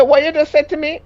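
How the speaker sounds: retold outraged question; shocked retort. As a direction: act out disbelief. Retold confrontation.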